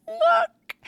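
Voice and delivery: squealed very high and loud; excited reveal. Retold or imitated. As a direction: burst out in delight to a child. Showing girl a ballerina book.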